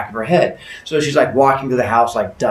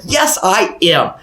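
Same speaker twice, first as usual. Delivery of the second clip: slow, raised, self-satisfied answer; proud boast; 7 semitones higher, 3 dB louder, much slower than usual. Acted. Husband called a special boy, proud drawn-out agreement. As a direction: stretch each word with childish pride.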